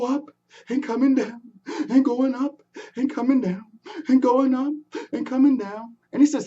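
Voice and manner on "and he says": ordinary delivery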